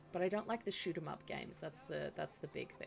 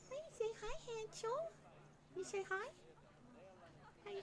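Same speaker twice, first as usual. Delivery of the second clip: pet talk; 14 semitones higher, 10 dB quieter than usual. Real, not acted. Streamer asking Hansel to say hi, cooing. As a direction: coax a pet sweetly, voice up high.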